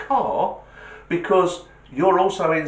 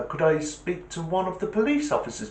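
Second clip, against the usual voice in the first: lightly acted, higher caller voice; talking to a child; 7 semitones higher than usual. Caller asking a child for an officer, higher voice. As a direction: politely ask a child, pitched up.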